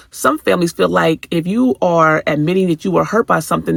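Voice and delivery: ordinary talk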